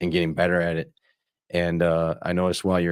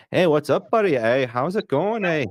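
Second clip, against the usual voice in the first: put-on Upper Peninsula accent; 9 semitones higher, 4 dB louder than usual. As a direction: greet a buddy in a hammed-up Yooper accent. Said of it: "I started getting that accent of the U P".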